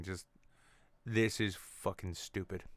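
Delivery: ordinary talk, nothing marked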